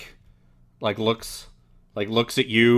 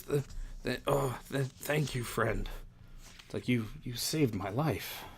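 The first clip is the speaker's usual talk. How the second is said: soft, halting, slightly raised voice; weak rescued man; 3 semitones higher, 9 dB quieter than usual. Acted. Dazed survivor thanks the friend who saved his life, weak and halting. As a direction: stammer faint thanks as you come to.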